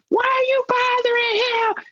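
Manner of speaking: squeaky high mocking voice of drunk friends. Drunk friends confronting the cop, in a squeaky high parody voice.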